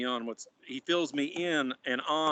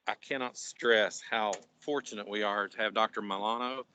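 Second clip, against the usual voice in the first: usual speaking voice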